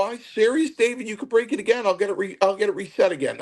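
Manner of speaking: high-pitched mock protest; comically panicked, high retelling; retold anxious pleading. Retells his own anxious protest mid-match.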